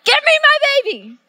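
high, loud, laughing yell; mock-panicked mother yelling; frantic mom shout. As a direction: demand your child back in a frantic, half-joking shout. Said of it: "I'm like".

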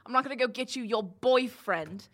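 scornful jailer laying down threats; threatening jailer. Acted. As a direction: lean hard on the insults.

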